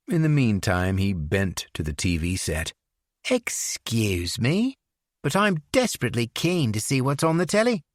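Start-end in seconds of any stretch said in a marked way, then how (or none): 3.3-7.8 put-on English accent. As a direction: airily excuse yourself in an English accent. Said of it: "lapsed into his English accent".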